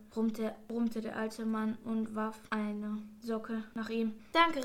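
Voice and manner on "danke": higher, louder quick call; called-out thanks. A narrator's reading. Malte thanking the old man for the sock, bright call. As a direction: call your thanks across the room. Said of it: "rief Malte".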